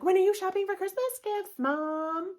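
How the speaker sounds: put-on high, loud, nagging kid voice; whiny kids. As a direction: whine it up like a pestering child. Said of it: "my kids are like".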